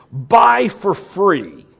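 high, drawn-out street cry; loud calling out. Acted. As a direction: holler the offer slowly. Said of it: "this loud calling out".

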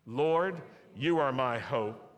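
slow, loud, emphatic confession of faith; slow declaration. Shown as a demonstration. Preacher leading a call-and-response, slow and loud. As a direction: proclaim each word slowly to the congregation. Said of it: "Can you say that?".

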